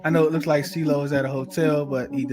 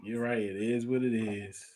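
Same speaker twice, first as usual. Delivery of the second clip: resigned; 5 semitones lower, 6 dB quieter than usual. Real, not acted. Resigned after a loss.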